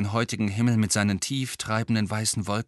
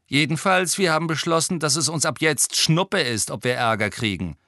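higher-pitched, brisk twin voice; lively higher voice for the twin; lightly voiced cheeky twin; 7 semitones higher, 4 dB louder than usual. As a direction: play one of the troublemaking twins, pitched up.

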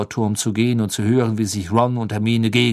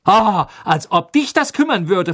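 higher, louder mocking retort; smirking; 8 semitones higher, 5 dB louder than usual. A narrator's reading. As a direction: tease Ron scornfully. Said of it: "feixte Hermine".

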